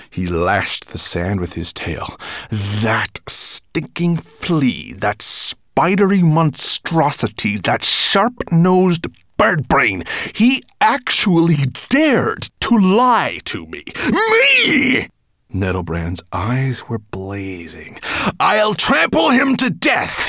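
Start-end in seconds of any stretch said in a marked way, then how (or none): 2.5-15.1 slow growl building to a high, loud shout; growling rage. A narrator's reading. Nettlebrand raging at being lied to, growl rising to shout. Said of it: "Straightening up and growling". 18.4-20.3 slow, high, loud snarl; furious snarl. A narrator's reading. Nettlebrand threatening his lying spy, snarled. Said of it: "he snarled at the desert sands".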